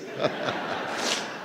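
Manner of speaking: riso soprado curto; risada. Real, not acted. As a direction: ria baixinho. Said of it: "Eu estou rindo para não chorar". (risada contida)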